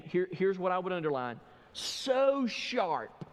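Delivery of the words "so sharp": "so sharp" high and spaced out; emphatic, high-pitched "so sharp"; stress on sharp. Real, not acted. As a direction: lift and lean on each word of "so sharp". Preacher highlights phrase to underline.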